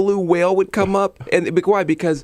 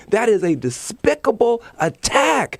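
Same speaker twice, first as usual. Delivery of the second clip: impassioned, higher-pitched indignation; fired up; 6 semitones higher than usual. Real, not acted. Guest condemns governors' attack on poor people, fired up. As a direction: get fired up, pitch climbing, hammering key words. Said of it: "he just got all fired up".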